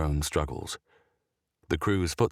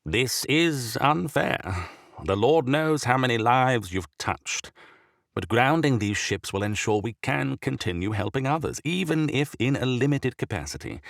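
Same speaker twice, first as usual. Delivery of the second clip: heavy, rasping ailing bishop; sickly bishop voice; 6 semitones higher than usual. A narrator's reading. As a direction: speak like a sick old prelate.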